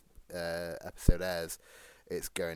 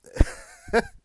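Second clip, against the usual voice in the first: high giggle; 14 dB louder than usual. Real, not acted. Laughs at own burp.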